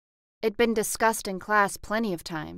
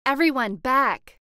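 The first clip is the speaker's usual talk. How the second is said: high, loud bark of command; barked order; 5 semitones higher, 8 dB louder than usual. A narrator's reading. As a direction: snap the crowd back loudly.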